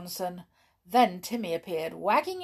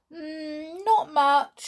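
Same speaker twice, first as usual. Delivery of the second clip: high, drawn-out hum, then louder answer; cook calling back; 10 semitones higher, 7 dB louder, much slower than usual. A narrator's reading. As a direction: call it through from the kitchen. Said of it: "said Joanna from the kitchen".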